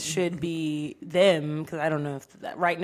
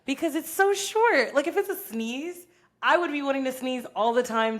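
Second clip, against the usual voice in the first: excited; 8 semitones higher, 4 dB louder than usual. Real, not acted. Excited comparison to sneezing.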